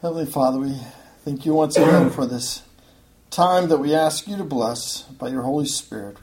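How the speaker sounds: subdued, reverent prayer; measured prayer with pauses between phrases; public prayer. Real, not acted. Leads the congregation in prayer.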